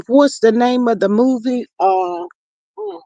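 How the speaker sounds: ordinary talk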